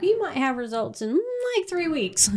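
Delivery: sing-song brush-off; mock official voice. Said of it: "they're like".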